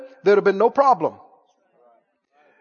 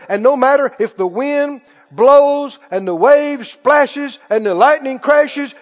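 ordinary delivery

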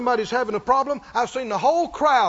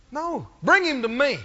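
ordinary delivery

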